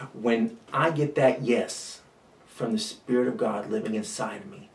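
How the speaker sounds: ordinary talk, nothing marked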